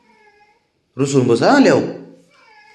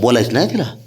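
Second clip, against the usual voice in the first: normal talking voice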